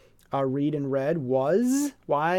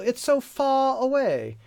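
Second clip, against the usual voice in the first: mock British accent; 10 semitones higher, slower than usual.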